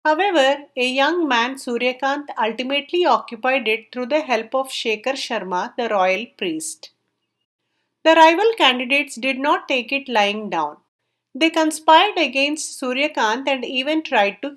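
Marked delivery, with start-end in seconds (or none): none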